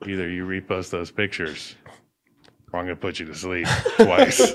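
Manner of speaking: mock hitman threat. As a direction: deliver a measured threat, played for laughs. Acted hitman threat in pitch.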